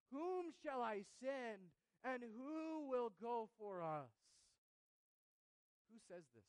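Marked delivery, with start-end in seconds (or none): 0.1-4.1 loud, slow call of God; the Lord calling. Acted. Preacher voicing God's call to Isaiah, loud and slow. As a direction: call out slowly with great weight.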